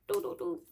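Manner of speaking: high sung doo doo doo like a dead phone line; hang-up beeps. Retold or imitated.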